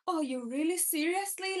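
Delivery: usual speaking voice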